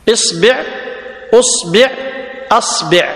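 إصبع، أصبع، أصبع said isbi', usbi', asbi'; kasra on ba. Shown as a demonstration. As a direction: list three openings i, u, a, each ending -bi'. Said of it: "بكسر الباء مثلثة الهمز".

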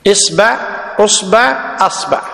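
إصبع، أصبع، أصبع said isba', usba', asba'; fatha on ba. Shown as a demonstration. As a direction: list three openings i, u, a, each ending -ba'. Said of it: "تكتب بفتح الباء".

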